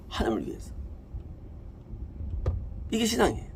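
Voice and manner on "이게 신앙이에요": ordinary delivery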